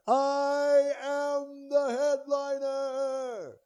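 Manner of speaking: very high, drawn-out hero proclamation; He-Man voice. Retold or imitated. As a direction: draw it out like a cartoon hero. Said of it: "He-Man".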